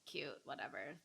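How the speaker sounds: whispered, soft aside. Real, not acted. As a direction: breathe it almost silently. Tender nursing aside.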